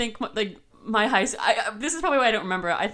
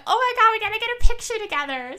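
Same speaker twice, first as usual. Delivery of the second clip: high, gushing mock excitement; excited group squeal; 13 semitones higher, 5 dB louder, faster than usual. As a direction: gush it quickly like thrilled schoolgirls. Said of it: "we were all like".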